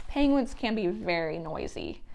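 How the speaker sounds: ordinary delivery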